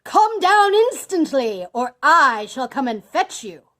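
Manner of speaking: furious booming command, pitched high; enraged villain shouting threats; top-of-voice shout. A narrator's reading. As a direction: bellow the order with drawn-out force. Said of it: "cried out at the top of his voice".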